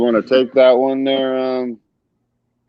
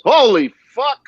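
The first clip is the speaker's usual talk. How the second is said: startled shout; 10 semitones higher, 3 dB louder than usual. Real, not acted. Startled by a creepy picture.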